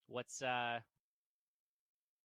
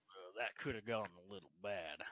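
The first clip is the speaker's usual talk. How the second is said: rueful, low in-character remark; fighter character voice; 5 semitones lower, 3 dB quieter than usual. Acted.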